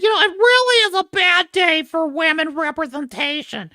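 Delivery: high mocking voice; mocking quote. Mocking the critic's lament in a high voice. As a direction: sneer her words back in a high voice. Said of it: "she said".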